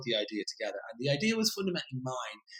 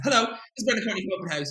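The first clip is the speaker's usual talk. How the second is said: bright, higher, louder chirpy hello; cheery phone greeting; 9 semitones higher, 6 dB louder than usual. Retold or imitated. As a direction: chirp it like a confident cold caller. Cheeky cold call to editor.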